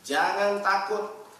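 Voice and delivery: high, loud, forceful exhortation; fiery preaching. Acted. Preacher exhorts the congregation, loud and forceful. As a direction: thunder it at the congregation.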